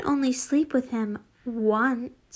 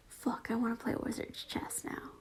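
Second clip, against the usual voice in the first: soft unvoiced aside to listeners; whispered aside; 13 dB quieter than usual. Real, not acted. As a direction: whisper it like a secret complaint.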